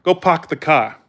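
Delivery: imitated New England "park the car" without R's; dropped-R Boston accent; Northern accent. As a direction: say it "pahk the cah", New England style. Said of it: "Like, we say".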